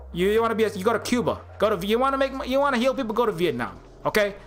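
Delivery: hammed-up New York wiseguy accent. A mock boss laying down the law to doctors in a New York accent. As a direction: pile on the New York, amateurishly. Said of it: "how much more New York I can put into that accent".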